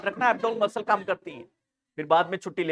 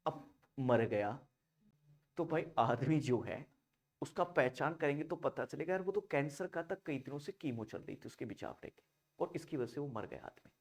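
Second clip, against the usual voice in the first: hushed telling; 6 semitones lower, 15 dB quieter than usual. Real, not acted. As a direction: tell the grim case almost under your breath. Lowers voice telling the case. (धीमी दबी आवाज़ में)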